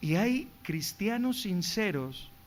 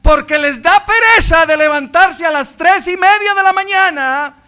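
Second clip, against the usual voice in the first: loud, higher preaching; preaching at full force; 8 semitones higher, 16 dB louder than usual. Acted. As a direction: drive it home to the congregation. (predicación a todo pulmón)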